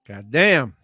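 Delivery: usual speaking voice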